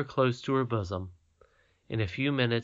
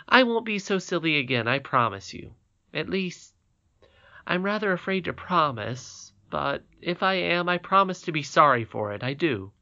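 put-on higher, eager young princess promising; earnest little girl; 6 semitones higher, 5 dB louder than usual. Irene promises.

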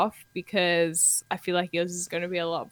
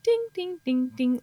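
sung ding jingle; 6 semitones higher than usual. Shown as a demonstration. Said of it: "imagine a little bit of".

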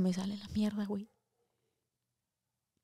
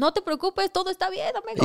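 much higher, sing-song fake calm; mock reassuring; 9 semitones higher than usual. Reassuring her sick boyfriend while hiding her own panic, higher fake-calm voice. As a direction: cover your panic with a bright soothing voice.

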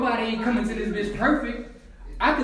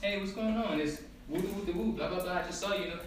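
quiet; 9 dB quieter than usual. Pretend. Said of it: "Talk to me".

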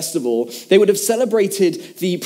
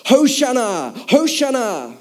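higher, drawn-out crowd cry; mock crowd shouting; 5 semitones higher, much slower than usual. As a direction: imitate worshippers calling out, slowly. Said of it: "they would start shouting to each other".

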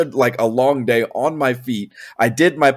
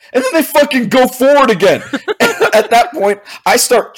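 laughing; 11 semitones higher, 3 dB louder than usual. Real, not acted. Laughing in disbelief.

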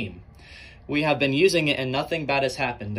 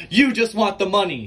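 heated argument; 5 semitones higher, 5 dB louder than usual. Acted. Heated debate, speakers clash.

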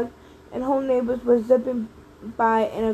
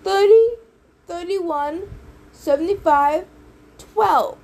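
louder, higher calls like a quarterback; belted out; 6 semitones higher, 5 dB louder, slower than usual. A narrator's reading. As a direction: bark out a play call. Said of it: "They belted it out like a quarterback".

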